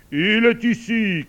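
high, loud, drawn-out cry; booming exclamation from the sun; triumphant shout. A narrator's reading. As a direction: call out the discovery slowly. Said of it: "cria le soleil".

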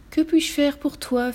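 slow, high, lightly voiced genie; genie at your service. A narrator's reading. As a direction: offer your services slowly. Genie appears to serve.

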